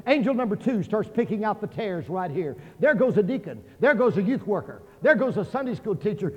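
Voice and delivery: ordinary delivery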